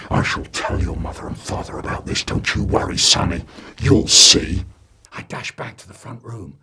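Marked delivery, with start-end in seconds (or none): 0.1-4.6 low breathy threat; menacing threat. Acted. Neighbour warning the boy, low menacing. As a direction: warn him darkly.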